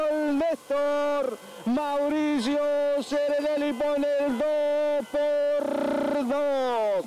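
high, slow drawn-out goal call. Real, not acted. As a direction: stretch out the scorer's name.

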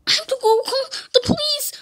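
high, fast panicked voice; play-acted panic. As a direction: rush it out in mock fright. Panicked by zombies.

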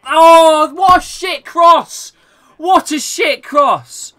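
high, loud, drawn-out dismay; exasperated shout. Real, not acted. Gamer bemoaning a shit cross, exasperated shouting. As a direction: yell it slowly in real disgust.

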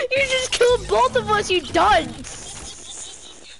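high-pitched cry of disbelief; worked-up, half-laughing squeal; exasperated outburst. Real, not acted. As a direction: wail it at a bungling teammate. Friend got both players killed.

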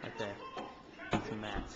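documentary narrator. Acted. Hushed wildlife narration.

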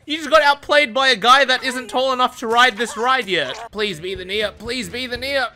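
ordinary talk, nothing marked

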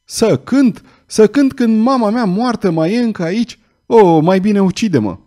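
high-pitched revolted protest; indignant cry. A narrator's reading. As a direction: cry out in outraged disbelief. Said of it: "se ridică și, revoltată, strigă". (strigăt revoltat, pe ton înalt)

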